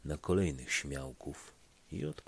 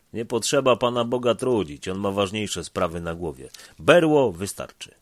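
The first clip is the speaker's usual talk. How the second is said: louder, higher, brash retort; bold retort; 4 semitones higher, 11 dB louder than usual. Acted. Stefan brushing off the prayer, loud and confident. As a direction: toss it off boldly, full voice.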